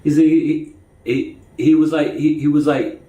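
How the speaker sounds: stuttering on he was like. Real, not acted. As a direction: catch and repeat the words, stuttering. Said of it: "Now, now I'm getting my stutter".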